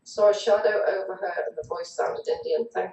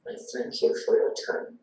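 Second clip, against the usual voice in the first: slow, half-whispered trance voice; channeled message; 7 semitones lower, slower than usual. Acted. Channeler passing a spirit's message to the group, slow and hushed. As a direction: speak slowly, with long pauses, in a hushed otherworldly delivery. Speaking as channeled entity during session.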